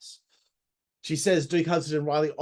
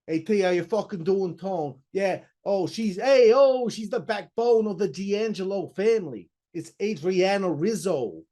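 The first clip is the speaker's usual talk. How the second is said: mock Rizzo accent; 5 semitones higher, 4 dB louder than usual. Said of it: "her accent is unbelievably awesome".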